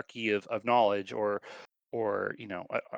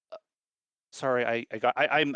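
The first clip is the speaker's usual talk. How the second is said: ordinary talk, nothing marked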